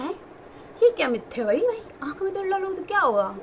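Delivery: high-pitched incredulous retort; mock disbelief. Sister retelling her reply to advice on oil in eyes, incredulous. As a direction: retell it as a baffled, scoffing comeback.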